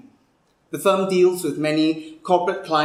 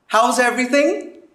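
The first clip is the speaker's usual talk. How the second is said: higher-pitched, eager reunion question; effusive small talk; 7 semitones higher, 3 dB louder than usual. Acted. Old schoolfriend catching up at the reunion, effusive. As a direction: chirp it at an old classmate.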